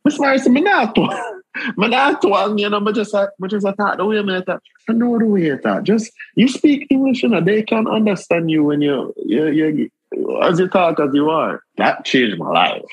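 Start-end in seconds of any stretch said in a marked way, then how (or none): 0.1-11.6 much higher protest in broad Jamaican patois. Retold or imitated. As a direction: insist in Jamaican patois that you don't twang. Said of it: "And me, I said".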